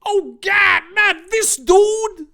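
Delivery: high, loud, drawn-out despairing wail; exasperated cry. Acted. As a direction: throw up your hands in cartoon despair. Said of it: "and Jara was like".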